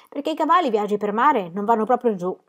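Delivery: plain reading voice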